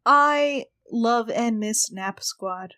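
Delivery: usual speaking voice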